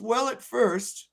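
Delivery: hammed-up bashful mother; coy punchline in a lightly voiced mother's tone. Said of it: "she goes".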